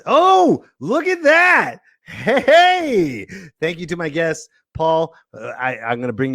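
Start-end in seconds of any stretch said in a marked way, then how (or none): 0.1-3.3 high delighted exclamation; light up with relief; excited. Real, not acted. Camera finally works.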